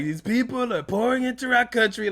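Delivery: high, exaggerated Trump-style put-on voice; Trump impression. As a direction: ham up Trump's rally cadence, pitched high. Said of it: "he always talks the same, like".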